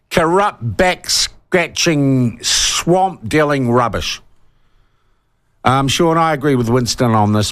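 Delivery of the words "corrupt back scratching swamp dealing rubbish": each word slow and weighted; slow spat-out insults. Real, not acted. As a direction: spell out the contempt word by word. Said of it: "I'm getting worked up".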